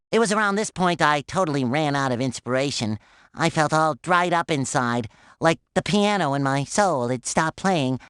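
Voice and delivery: low, drained lament; mock despondent. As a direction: confess it low, as if dried up inside. Recalls losing all musical inspiration.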